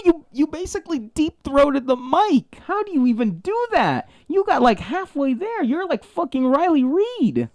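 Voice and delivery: high-pitched incredulous outburst; appalled teasing. Real, not acted. Host teasing co-host about the mic, appalled and incredulous. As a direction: accuse them in scandalised disbelief.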